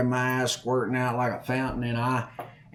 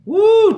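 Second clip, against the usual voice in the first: sudden high-pitched "woo"; big, high holler; high loud whoop; 13 dB louder than usual. Real, not acted. Whoop in the telling.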